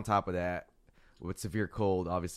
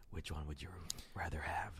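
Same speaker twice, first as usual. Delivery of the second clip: whisper; 4 semitones lower, 14 dB quieter than usual. Real, not acted. Whispered question.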